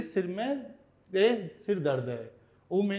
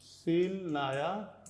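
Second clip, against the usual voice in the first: very slow, softer, stretched words; dictation pace; 5 dB quieter, much slower than usual. Shown as a demonstration. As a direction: dictate each word slowly while writing on screen. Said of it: "इस sentence को मैं यहाँ लिख देता हूँ".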